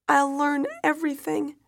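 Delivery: high, loud, anguished vow; desperate promise. A narrator's reading. Widow promising daughter everything, desperate.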